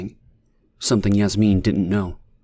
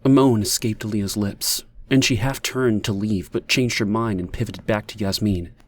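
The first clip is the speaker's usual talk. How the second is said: usual speaking voice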